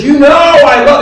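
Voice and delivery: high, loud outburst; upset. Retold or imitated. Preacher as Peter, upset at being asked a third time, upset outburst. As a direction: cry it out in exasperated protest. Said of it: "And Peter gets upset. He gets upset".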